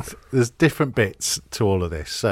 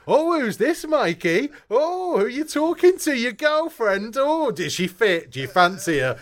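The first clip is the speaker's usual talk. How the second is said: high, loud mocking tease down the phone; teasing mate shouting; 13 semitones higher than usual. [name] teasing Mikey on speakerphone, high mocking shout. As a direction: wind up your mate in a squeaky shout. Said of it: "Like, really loud".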